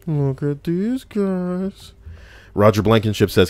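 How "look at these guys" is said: much higher, very slow goofy voice; high silly voice. Acted. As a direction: squeak it out, slowly, like a cartoon. Repeats in a put-on voice.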